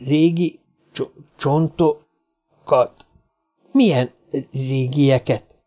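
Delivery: frightened little man asking about the bones, halting; nervous stammer. A narrator's reading. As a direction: drag the words out uneasily.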